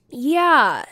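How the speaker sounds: usual speaking voice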